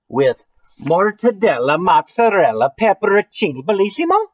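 loud, high, hammed-up Italian accent; terrible Italian accent. Reader listing foods falling on the hill, hammed-up Italian accent. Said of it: "I apologize for my terrible accent there".

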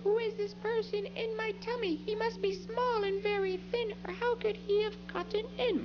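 put-on high little child voice; small child voice. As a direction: speak like a small worried child. Child voice quoting self.

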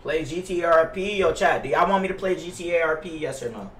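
ordinary delivery

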